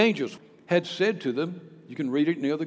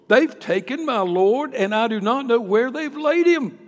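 distressed Mary; 7 semitones higher, 8 dB louder than usual. Acted. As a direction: cry out for her lost Lord. Mary's distressed answer.

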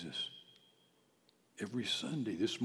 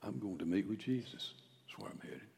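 soft, unvoiced whisper; whispered witness; 4 semitones lower, 6 dB quieter than usual. Shown as a demonstration. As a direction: say it like a secret good news.

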